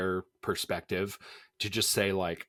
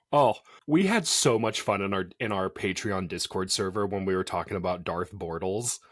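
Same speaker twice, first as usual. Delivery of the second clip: ordinary delivery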